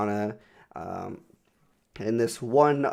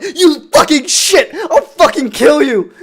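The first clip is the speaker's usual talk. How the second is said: slow, much higher and louder yell; retold furious yell; 13 dB louder, slower than usual. Driver yelling out the window at the hobo. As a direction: scream it out the car window. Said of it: "I just yell out".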